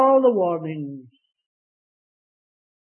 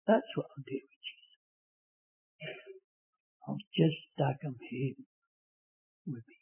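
Scottish dialect; 2 semitones lower, 5 dB quieter than usual. Retold or imitated. Old Scottish lady answering the preacher, softly in Scottish dialect. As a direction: quietly say it as a Highland Scottish lady would. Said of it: "in her own Scottish dialect".

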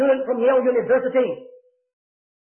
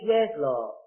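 quiet surrender; 4 semitones lower, 4 dB quieter than usual. Retold or imitated. Surrender answer inside quote.

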